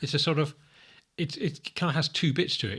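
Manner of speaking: normal talking voice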